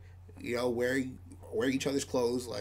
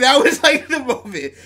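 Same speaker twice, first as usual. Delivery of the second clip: laughing; 12 semitones higher, 15 dB louder than usual. Real, not acted. Laughing while telling story.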